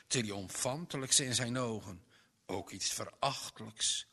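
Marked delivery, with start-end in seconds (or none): none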